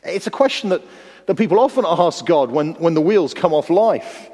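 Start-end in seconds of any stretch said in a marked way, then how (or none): none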